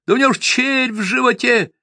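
very high yelling accusation; piercing thin yell. A narrator's reading. Peasant denounces the mare to the tavern, piercing yell. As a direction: keep screeching at the whole tavern. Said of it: "заорал на весь кабак пронзительно тонким голосом".

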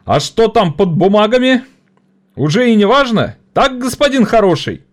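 high, loud mocking rally; triumphant orator. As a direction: seize the theme to jeer. (насмешливо)

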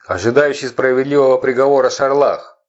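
loud, high solemn proclamation; solemn herald. A narrator's reading. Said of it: "возгласил торжественнее".

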